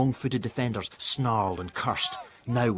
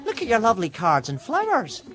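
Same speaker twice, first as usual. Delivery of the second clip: lightly voiced girl; 14 semitones higher, 5 dB louder than usual. A narrator's reading.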